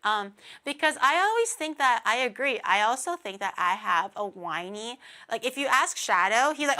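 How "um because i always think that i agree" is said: normal talking voice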